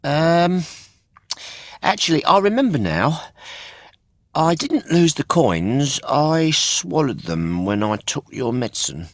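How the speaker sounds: high, quick hesitant excuse; fumbling puppet. Acted. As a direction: scramble for a new story. Pinocchio backtracks on lie.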